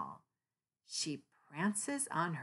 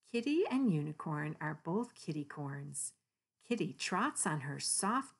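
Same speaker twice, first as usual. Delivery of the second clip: ordinary delivery, nothing marked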